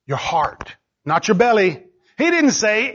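ordinary delivery

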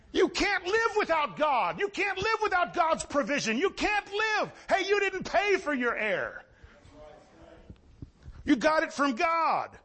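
high-pitched, insistent pulpit cry; fervent preaching. Real, not acted. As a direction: drive each line home to the congregation. Preaching at full force.

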